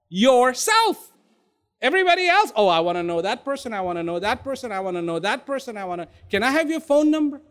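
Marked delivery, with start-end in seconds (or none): 2.6-7.4 rapid-fire eager chatter; imitating breathless eagerness at double speed; mock eagerness. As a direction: rattle it off like a keen socializer. Mimics people eager to know others.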